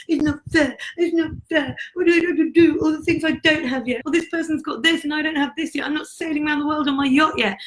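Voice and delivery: high, whiny put-on voice mimicking a complainer; mock self-pitying whine. Mocking the listener's inner complaints in a high whiny voice. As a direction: whine like a sulky victim. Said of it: "how many times have you had that conversation with yourself".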